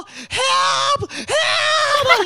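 high, loud desperate cry for help; yelling for help. Retold or imitated. Traveler yelling to ground staff at the gate, desperate shout. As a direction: shout it like you're stranded at the gate. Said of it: "我这时候呐喊说".

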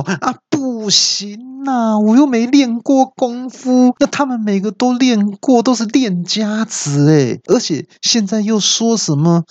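put-on a scared kid begging to be let off; panicked yell. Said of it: "就大叫".

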